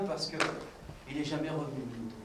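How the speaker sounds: usual speaking voice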